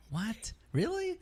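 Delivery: disbelieving squeak of a question; incredulous disbelief. Retold or imitated. Telling the banker he can't believe it, incredulous. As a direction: pitch jumps way up on really.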